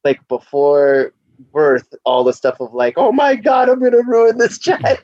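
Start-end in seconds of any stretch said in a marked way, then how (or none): 3.0-5.0 high, frantic mock panic. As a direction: wail it like a panicking new parent.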